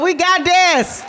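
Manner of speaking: we got this, shouted slow; rallying shout. Real, not acted. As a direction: fire up the crowd. Rallying the crowd.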